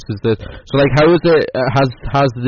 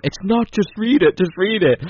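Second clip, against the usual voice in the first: high insistent squeak of a caller; begging him to just read it, pitched up; high pleading imitation; 10 semitones higher than usual. Producer on the phone urging the host, high pleading imitation. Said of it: "on the phone going".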